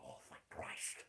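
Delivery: breathy whisper of relief. Retold or imitated. Whispering to himself as the girl finally leaves, relieved. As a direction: mutter it under your breath, relieved. Relieved aside after long awkward talk.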